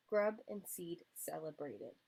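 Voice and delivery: ordinary delivery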